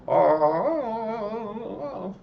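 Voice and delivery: high, long jungle call in a mock Tarzan style; Tarzan yell. Calling the animals from the bush with a high Tarzan yell. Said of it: "I did the old Tarzan yell".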